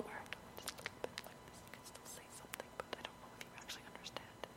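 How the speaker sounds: fast, barely audible whisper; unintelligible whisper. Shown as a demonstration. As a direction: whisper fast so the words blur. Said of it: "it can go into unintelligible".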